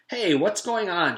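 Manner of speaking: loud, high call over the crowd; shout. A narrator's reading. Girl on crowded stairs shouts to find out what's happening, loud. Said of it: "shouted Joy".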